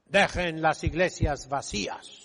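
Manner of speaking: normal talking voice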